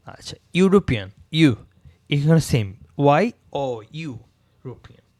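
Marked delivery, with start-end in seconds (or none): 3.0-4.1 ওয়াই ও ইউ sounded as a Y glide; ওয়াই ও ইউ showing the Y start; spelled-out sound. Shown as a demonstration. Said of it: "ওয়াই ও এরকম উচ্চারণ হচ্ছে".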